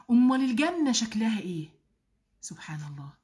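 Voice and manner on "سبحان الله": very soft, low whisper of praise; low, reverent whisper; hushed awe. Real, not acted. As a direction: murmur it under your breath in awe. Awed exclamation after wondering questions.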